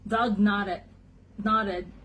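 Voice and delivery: usual speaking voice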